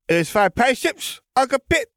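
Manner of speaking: put-on high-pitched toddler question; small child's voice. A narrator's reading. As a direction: ask in a little boy's very high voice. Toddler asks uncle to play.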